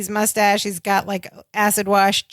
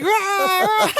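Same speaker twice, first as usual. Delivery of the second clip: high-pitched mock scream; imitated screaming; 8 semitones higher than usual. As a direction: imitate a man screaming while whirled about.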